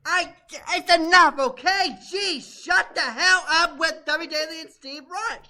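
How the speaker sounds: fed up, telling them to drop it; blowing up. Real, not acted. Guest blows up at hosts over the Debbie Daly talk, exasperated. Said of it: "I'm sorry that I blew up again".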